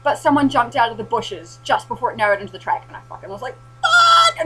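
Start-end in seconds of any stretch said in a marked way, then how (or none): 3.8-4.4 sudden high yelp of fright; startled shout. Retold or imitated. Zombie leaps from the bushes, she yelps a shocked curse. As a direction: jolt out a shocked curse, much higher and louder.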